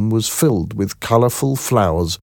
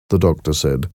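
usual speaking voice

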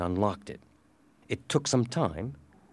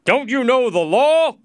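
high, loud challenge; overbearing, scolding shout; scornful slave dealer; 9 dB louder than usual. A narrator's reading. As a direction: throw the question at them loudly.

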